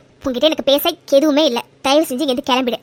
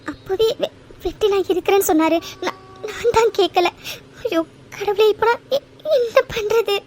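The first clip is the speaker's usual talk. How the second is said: frightened; 5 semitones higher than usual. Acted. Woman alone, frightened of stalker.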